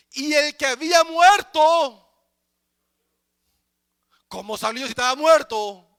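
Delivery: high, shouted sermon questioning; full-force preaching. Real, not acted. Preacher asks how a dead man could rise, full-force preaching. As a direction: fire out incredulous questions at full volume.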